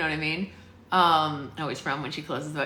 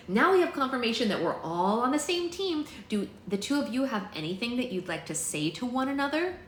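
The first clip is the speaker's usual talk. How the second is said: put-on higher-pitched girl leader; lightly acted storybook heroine; Bristol, addressing the group; 6 semitones higher than usual. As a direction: voice an earnest young team leader, pitched up.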